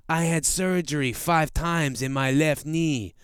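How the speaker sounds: imitated Mexican accent; lightly mimicked Mexican accent; Rey Mysterio. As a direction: put on a Mexican-accented wrestler's promo voice. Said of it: "that's how he says it with a, he sort of Mexican accent".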